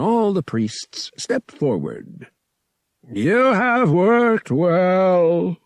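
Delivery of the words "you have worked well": slow, high, loud pronouncement; solemn high priest. Acted. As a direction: proclaim it from the altar, drawn out. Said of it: "the high priest pronounced".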